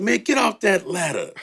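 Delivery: high, half-laughing retold shout; mock yell at the guy on the ladder. Producer yelling at the rapper to get off the ladder, mock yell. Seeing the botched paint job.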